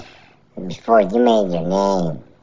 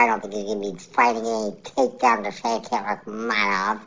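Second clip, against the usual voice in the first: normal talking voice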